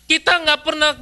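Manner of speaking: ordinary talk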